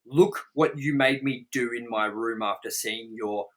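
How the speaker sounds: usual speaking voice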